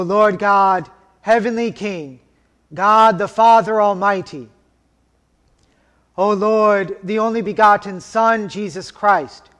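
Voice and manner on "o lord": normal talking voice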